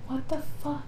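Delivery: high, hushed mock disbelief; stunned disbelief. Narrator finding the brother now holding him up, hushed disbelief. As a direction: drop the volume and lift the pitch, as if stunned. Retold stunned reaction to passed-around drunk.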